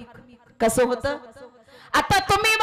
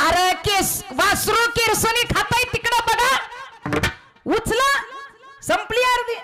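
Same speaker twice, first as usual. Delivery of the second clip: mock yell; 8 semitones higher than usual. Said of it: "जोरात बसकलं".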